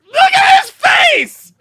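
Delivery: loud, slow, drawn-out shout. Real, not acted. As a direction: yell it out in disbelief. Mocking clip guy's face.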